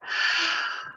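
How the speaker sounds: long sighing out-breath; big wordless exhale; demonstrated exhale. Shown as a demonstration. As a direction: breathe out audibly to lead the class. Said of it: "Breathe out".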